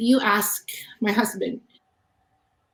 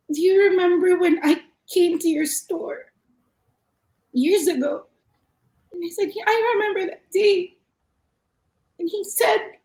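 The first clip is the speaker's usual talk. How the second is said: holding back real tears while retelling; choked up recounting his words; tearful; 5 semitones higher than usual. Real, not acted. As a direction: voice lifting high and tight, on the edge of tears. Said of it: "you're gonna make me cry".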